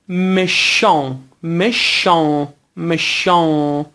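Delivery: مشان drawn out with a nasal final vowel; slow, nasal مشان, no hard final n; nasal French demo. Shown as a demonstration. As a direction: stretch the end of مشان through the nose. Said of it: "تلفظهای نزل یا همون تو دماغی".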